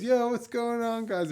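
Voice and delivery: high, chirpy hello; cheerful stoner greeting. Retold or imitated. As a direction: greet old friends breezily. Kid greeting them again.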